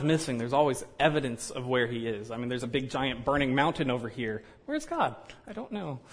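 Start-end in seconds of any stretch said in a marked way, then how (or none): 4.6-6.0 much higher, playful bewildered question; a playful mock-clueless question and shrug; mock puzzled search. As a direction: act lost, looking all around.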